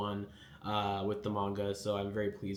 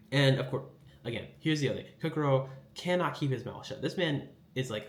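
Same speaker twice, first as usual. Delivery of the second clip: ordinary talk, nothing marked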